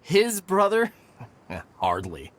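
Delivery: scornful laugh. Acted. Jeremy scorns being called brother.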